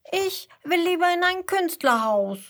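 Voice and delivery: put-on high, loud child character voice; high-pitched, insistent little one; little koala. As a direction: call out your wish as a stubborn little koala. Little koala objects to parents' plan.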